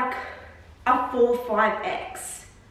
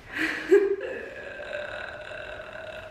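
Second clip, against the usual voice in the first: laugh; 5 semitones higher, 5 dB quieter than usual. Real, not acted. Laughs at failed try-on.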